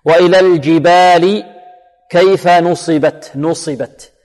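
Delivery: slow, drawn-out model of نصبت, sad kept thick; tajweed recitation. Shown as a demonstration. Teacher models the verse for students, careful recitation. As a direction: recite نصبت with light nun and heavy sad. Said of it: "النون مرقة والصاد ماذا؟ مفخمة".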